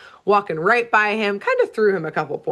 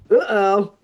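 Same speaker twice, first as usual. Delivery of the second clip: mock dismayed sorceress; 3 dB louder than usual. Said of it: "Morgana ... is like".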